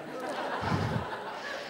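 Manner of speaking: very high breathless giggle, nearly silent; airy laugh. Real, not acted. Recalling the rude video on the bus, high airy laugh. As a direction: let a high wheezy laugh slip out.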